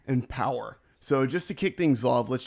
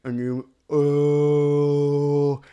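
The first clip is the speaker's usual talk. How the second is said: talking with a pencil in mouth; 3 semitones higher, 8 dB louder, much slower than usual. Shown as a demonstration. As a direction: speak around a pencil between teeth. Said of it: "put something like a pencil in your mouth like this".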